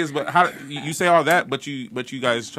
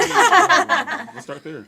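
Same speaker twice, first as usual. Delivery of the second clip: laughing the words out slowly; drawn-out laughing reply; 8 dB louder, much slower than usual. Real, not acted. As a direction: laugh through it, amused by the answer. Laughing at "it's complicated.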